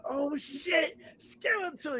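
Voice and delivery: excited shout. Retold or imitated. Excited fan meeting Skeletor.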